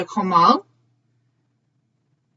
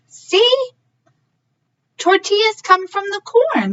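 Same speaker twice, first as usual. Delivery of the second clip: high prompt; 9 semitones higher, 4 dB louder than usual. Real, not acted. Teacher prompting children.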